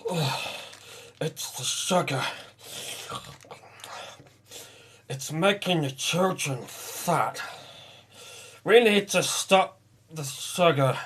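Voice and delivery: high, slow whiny mockery; mocking whiny voice. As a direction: whine like a couch potato. Mocking couch potatoes, high slow.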